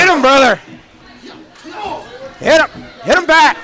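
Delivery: high, loud ringside yelling; excited shouting. Real, not acted. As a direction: urge the fighter on at full voice. Cheering on a brawl.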